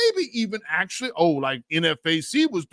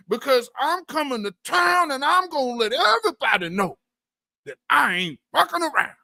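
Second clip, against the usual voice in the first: higher, hammed-up swagger of a would-be gangster; mock tough guy bragging to the crowd; 7 semitones higher than usual. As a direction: puff up and brag like a wannabe tough guy. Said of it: "go march out here in front of these folks like".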